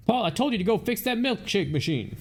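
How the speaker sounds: voiced character telling someone off; scolding game character. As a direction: nag him about the job.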